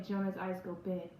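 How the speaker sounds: plain reading voice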